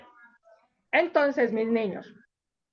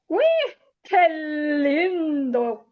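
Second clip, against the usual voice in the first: touched; 3 semitones higher, much slower than usual. Real, not acted. Teacher moved by story.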